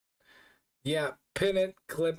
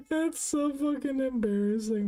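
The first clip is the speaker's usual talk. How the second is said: laughing; 12 semitones higher than usual. Real, not acted. Laughing at the clip.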